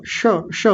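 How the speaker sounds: श, श hissed slowly; drawn-out sh sound. Shown as a demonstration. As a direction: hold the श hiss. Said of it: "इसका उच्चारण ज्यादा ध्यान रखना है".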